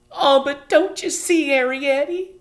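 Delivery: high, loud, breathless; gasping plea. A narrator's reading. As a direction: gasp it out anxiously. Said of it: "gasped Homily".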